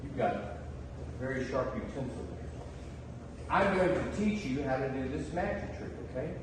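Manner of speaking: ordinary talk, nothing marked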